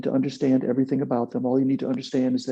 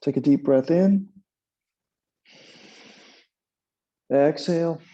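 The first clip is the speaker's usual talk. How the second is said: breathing cue; 3 semitones higher, 4 dB louder than usual. Shown as a demonstration. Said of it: "So let's begin".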